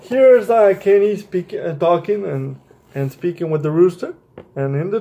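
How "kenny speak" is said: usual speaking voice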